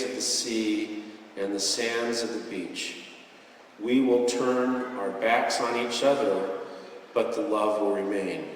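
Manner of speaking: measured, grave farewell; slow, solemn quote. Retold or imitated. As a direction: deliver the chief's words slowly for interpreters. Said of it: "I'll say this slowly for the interpreters".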